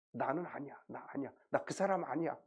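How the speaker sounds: hushed, repeated acted denial; uneasy denial. Peter denying to the onlookers, hushed uneasy denial. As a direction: deny it under the breath, uneasily. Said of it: "얼마나 가슴이 뜨끔했을까".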